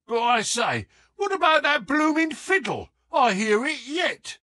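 high, loud, fast outburst of a puzzled thug; bewildered shout. A narrator's reading. Sam Merton shouting his confusion about the fiddle, loud and high. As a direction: blurt it out loud, baffled.

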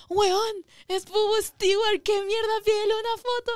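high, loud excited shrieking; excited fans. Retold or imitated. Fans shrieking at her to ask for a photo, excited. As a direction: squeal it like thrilled followers. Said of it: "Y la gente".

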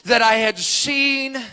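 loud, driving preacher's delivery; full-force preaching. Acted. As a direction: preach it at full volume, stretching key words.

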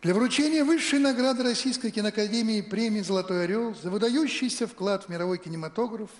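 ordinary talk

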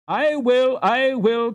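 high, loud and eager; eager cry. A narrator's reading. Boys eagerly volunteering for the trip, high excited cry. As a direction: boys jumping at the chance to go. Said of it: "cried the boys eagerly".

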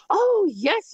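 put-on a delighted older lady's eager yes; thrilled yes; excited yes. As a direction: jump up high with eager joy. Foster mom's happy reply retold.

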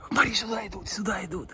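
excited hushed whisper; whispering while hiding. Real, not acted. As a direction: whisper so the horses don't bolt. Horses approaching, hiding.

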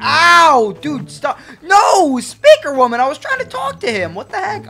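loud, high mock-hurt protest; yelp, then complain at a high pitch; indignant yelling. Host scolding Speaker Woman for hitting, loud indignant protest.

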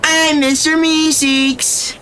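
high, drawn-out cartoon squeal; Mister Meeseeks. Streamer repeats his running impression, high cartoon voice. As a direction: announce yourself in a squeaky-high character voice. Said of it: "I really am Mister Meeseeks".

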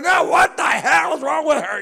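high, loud furious yell; angry shout. Retold or imitated. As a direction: bellow it at the other car. Retold road rage.

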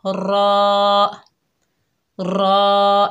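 रॉ full-mouthed and drawn out; a thick, rounded रॉ; रॉ with a trilled r; heavy raw. Shown as a demonstration. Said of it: "अब इसे पढ़िए".

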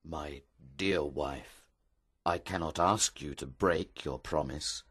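grave, softened reassurance; soft speaking. Acted. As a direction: speak gently, without anger. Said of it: "spoke softly to her".

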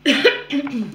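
loud cough; sharp cough. Real, not acted. Sore throat while recording.

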